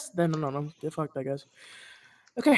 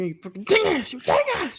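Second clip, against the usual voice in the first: mock scolding; 9 semitones higher, 4 dB louder than usual. Real, not acted.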